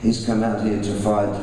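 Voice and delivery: usual speaking voice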